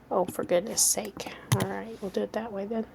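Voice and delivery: whispered grumble to herself; exasperated whisper. Real, not acted. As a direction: hush the annoyance. Frustrated whispered aside.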